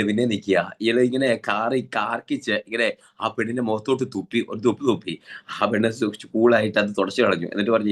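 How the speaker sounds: ordinary talk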